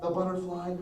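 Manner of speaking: usual speaking voice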